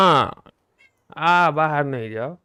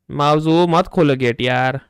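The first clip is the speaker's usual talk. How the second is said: louder call to stop the cat; 9 dB louder than usual. Real, not acted. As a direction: shout a warning to the cat. Calling cat away from gate. (बिल्ली को gate से रोकने के लिए ज़ोर से पुकारना)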